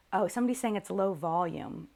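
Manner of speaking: ordinary talk, nothing marked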